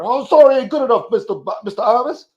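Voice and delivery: high, loud, scolding Reverend Al impression; outraged reverend scolding a joker; scolding preacher. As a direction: chew him out like an angry preacher. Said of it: "he probably, uh, be like".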